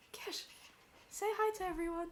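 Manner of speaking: high-pitched coaxing of a dog; pet talk. Real, not acted. Coaxing the dog to greet viewers.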